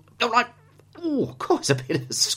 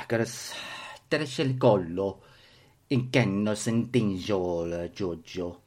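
self-conscious mock Italian gibberish; shy, half-hearted pretend Italian; reluctant fake Italian; 6 semitones lower, 3 dB quieter, slower than usual. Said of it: "I feel silly doing this".

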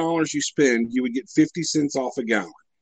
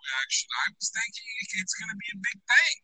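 far from mic; 7 semitones higher, 8 dB quieter, faster than usual. Real, not acted. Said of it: "You sound like you're far away right now".

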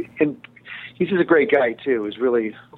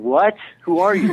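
mock startled question, a bit higher; baffled surprise; 6 semitones higher than usual. Director acting out his reaction to an actor dropping his accent, baffled. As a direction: act thrown by a stranger's voice.

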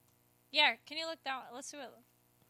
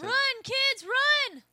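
yelling at the screen; 11 semitones higher, 4 dB louder, much slower than usual. Real, not acted. Yelling at movie characters.

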